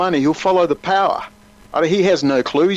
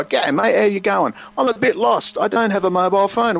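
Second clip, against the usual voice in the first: lightly voiced chatty stranger, higher; a touch of put-on friendliness; friendly stranger; 6 semitones higher than usual. British agent plays a lost tourist, mock friendly.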